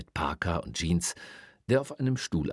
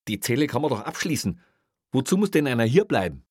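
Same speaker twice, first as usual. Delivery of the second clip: high, loud, exasperated question; agitated arguing; 7 semitones higher, 6 dB louder, faster than usual. Acted. Officer disputes the need to guard the cell, exasperated. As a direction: argue your way out of it. Kreutner argues with boss.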